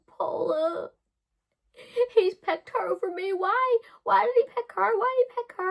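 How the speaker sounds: put-on high, loud, whining heartbroken teenager; whiny teen. Teen girl pleads with the adult Paula, high and whining. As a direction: wail it like a jilted teenage girl. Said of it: "I was like".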